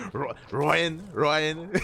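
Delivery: put-on high-pitched, laughing take on an Australian accent; imitating a friend's Aussie way of saying Ryan; teasing Australian accent through laughter; mock Australian accent. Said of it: "[name]'s god-awful Australian accent".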